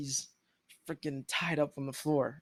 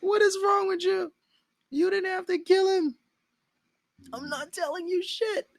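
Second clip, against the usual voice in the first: high, loud, tearful mock outburst; hammed-up high crying shout; panicked captive; 5 dB louder than usual. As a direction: plead and rage at the killer through tears. Captive cries out after friend killed.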